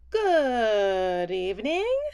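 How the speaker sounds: drawn-out whirr of a hello; whirring dragonfly. A narrator's reading. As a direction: let it glide like a dragonfly. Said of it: "whirred a dragonfly".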